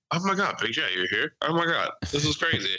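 high, gushing fake surprise. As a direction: act shocked to see an idol. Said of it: "walk out the door and be like".

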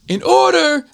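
high-pitched imitation of a yelling drill instructor; drill instructor's accent. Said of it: "I'm trying to do his accent".

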